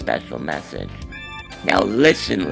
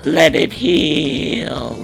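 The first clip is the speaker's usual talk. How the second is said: drawn-out, slow; much slower than usual. Acted. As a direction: stretch every word very slowly. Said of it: "and say".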